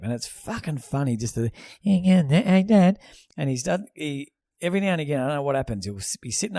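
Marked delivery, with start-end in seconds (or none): 1.8-3.0 higher, louder nasal-free mock son voice; hare-lip voice. Dad mimics his son talking with a tube up his nose, mock voice. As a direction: imitate the son's muffled talk through the nose tube. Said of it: "he sounds like he's got a hair lip".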